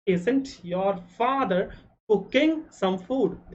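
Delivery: very slow, word by word; slow dictation. Shown as a demonstration. Teacher dictating to Hindi-speaking students, slowly and deliberately. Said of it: "teacher dictating the English sentence".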